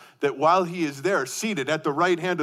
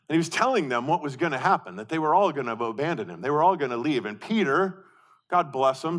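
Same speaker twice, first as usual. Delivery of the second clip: usual speaking voice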